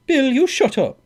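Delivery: high, loud rebuke; sharp order. A narrator's reading. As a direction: cut him off loudly. Scully silences cowboy.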